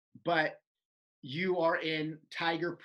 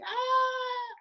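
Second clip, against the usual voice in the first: high mock scream of kids; retelling the kids screaming at the magic act, high mock scream; kids' scream. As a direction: squeal like a crowd of kids. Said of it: "the kids are screaming".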